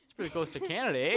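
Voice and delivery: put-on jokey Canadian accent with eh. Joking Canadian voice.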